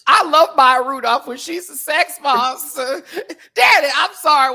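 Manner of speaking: high, loud, laughing near tears; laughing hard. Real, not acted. To the co-host about Maya Rudolph, laughing hard.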